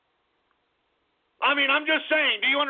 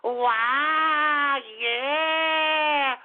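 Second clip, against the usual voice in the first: put-on high, sing-song praise as if to a small child; mock the way people coo at a slow kid; condescending sweet talk; 5 semitones higher, much slower than usual. Mocking how people talk down to Billy, sugary child praise. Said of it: "Listen to how people talk to you".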